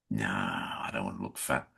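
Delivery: low, soft, offhand retold refusal; dismissive. Actor tells co-star he skipped the wetsuit, dismissive. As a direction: wave the idea away quietly, pitched well down.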